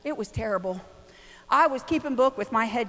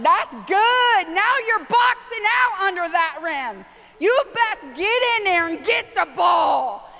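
cheering mom; 6 semitones higher, slower than usual. Retold or imitated. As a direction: yell him into the fight. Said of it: "I'm like".

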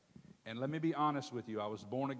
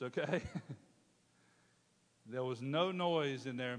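ordinary talk

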